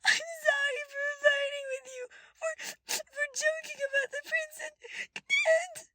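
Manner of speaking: very high, loud crying apology; sobbing. A narrator's reading. Evelyn apologizing to her brother, crying hard. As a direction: sob the apology out.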